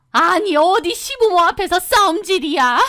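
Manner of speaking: high, shrill-free scolding from above; scolding mother-in-law. Retold or imitated. Mother-in-law scolding the couple for fighting. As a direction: shame her in front of elders.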